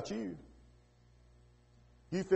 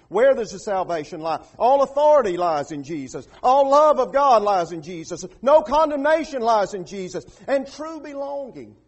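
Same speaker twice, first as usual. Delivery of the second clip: loud, rhythmic sermon proclamation; full-force preaching; 6 semitones higher, 11 dB louder than usual. Real, not acted. Preacher proclaiming what lies in Jesus, full-force preaching. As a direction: belt out each parallel line, climbing in pitch.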